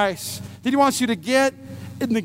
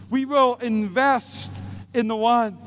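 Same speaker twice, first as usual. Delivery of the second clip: half-speed, punching WILL; preacher's slow emphasis; slow emphatic repeat; slower than usual. Acted. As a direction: land each word. Repeats key line slowly.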